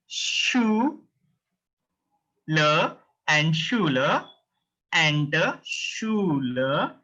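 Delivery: Schuler broken into a long "shoo" and "luh"; split into syllables. Shown as a demonstration. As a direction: stretch Schuler slowly, piece by piece. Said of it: "three characters".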